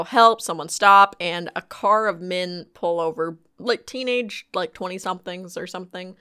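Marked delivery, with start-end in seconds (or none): 0.0-1.1 retold cry for help. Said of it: "you know".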